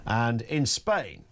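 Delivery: usual speaking voice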